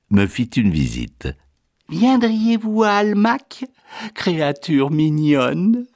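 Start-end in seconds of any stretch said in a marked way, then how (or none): 1.9-5.9 high-pitched society lady; coquettish duchess. Acted. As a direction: coo the invitation, slowing at the end. La duchesse invite le narrateur. (duchesse minaudière, voix haute)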